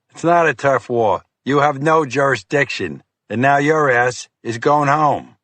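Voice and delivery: firm agent sending him home; stern FBI agent. Acted. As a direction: shut the argument down. Fiore dismisses turf war.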